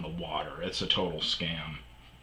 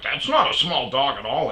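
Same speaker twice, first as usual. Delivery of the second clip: higher and louder indignant voice; mock maintenance man objecting; 7 semitones higher, 8 dB louder than usual. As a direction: protest with disbelief.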